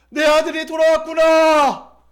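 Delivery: high, loud, drawn-out shout of a father; acted joyful cry. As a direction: cry out in overjoyed welcome. Father joyfully sees returning son. (기쁨에 차서 길게 외치는 소리)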